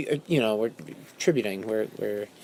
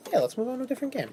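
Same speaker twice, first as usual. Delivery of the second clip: high silly voice; 8 semitones higher, 6 dB quieter, much faster than usual. Acted. Repeating phrase in silly voices.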